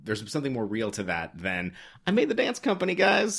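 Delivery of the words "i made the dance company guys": high-pitched, naive little boast; mock triumph. Dancer announces making the company, mock triumphant. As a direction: put on a gushing, high winner's voice. Mocking the safe happy-ending attitude.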